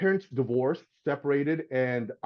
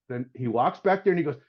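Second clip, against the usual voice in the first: ordinary delivery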